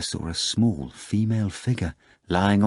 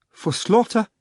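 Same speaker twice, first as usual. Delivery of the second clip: much higher, alarmed cry; cried fearfully; 13 semitones higher, 4 dB louder than usual. A narrator's reading. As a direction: gasp it out in fright. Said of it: "Margarita cried fearfully".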